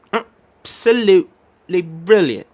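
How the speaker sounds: very slow "Absolutely brilliant" in a put-on British voice, stress on "Absolutely"; British accent. Shown as a demonstration. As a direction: drag it out like a posh Brit. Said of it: "saying absolutely in a British accent".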